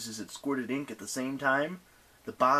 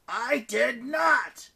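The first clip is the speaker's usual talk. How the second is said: high, drawn-out yell of denial; 13 semitones higher, 4 dB louder, much slower than usual. A narrator's reading. Eris denying that she hit anyone, yelling. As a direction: yell back high and slow. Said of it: "yelled Eris".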